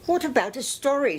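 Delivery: put-on high, eager little English boy; Christopher Robin. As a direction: ask brightly, like a small boy wanting a story. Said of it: "said Christopher Robin".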